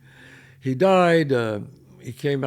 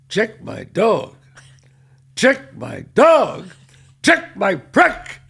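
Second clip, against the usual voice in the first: slow, drawn out, getting louder and higher; slow disbelief building to outrage; 6 semitones higher, 6 dB louder, much slower than usual. Retold or imitated. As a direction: repeat it slowly, then explode. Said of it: "looked at him and said".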